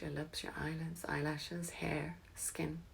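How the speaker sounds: fading into a whisper; soft spa whisper. Acted. Relaxation cue fading.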